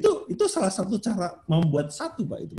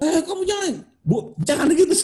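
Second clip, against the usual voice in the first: high-pitched mock protest; quick, high acted interruption; retold protest; 13 semitones higher than usual. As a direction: jump up high as if cutting someone off. Acting out reaction to crying child.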